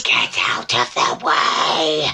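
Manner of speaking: low, drawn-out mock threat; mock menace. Co-host joining the joke at slow walkers, mock menace.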